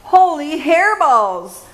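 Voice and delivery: high, loud startled cry; surprised kitten squeal; excited exclaim. A narrator's reading. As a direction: blurt it out in shock. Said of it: "exclaimed Skippy John Jones".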